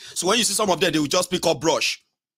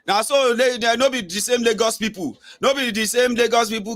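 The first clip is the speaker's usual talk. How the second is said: higher, mock scoffing voice; mocking critic; 7 semitones higher than usual. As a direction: imitate a sneering critic, higher. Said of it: "who have been like".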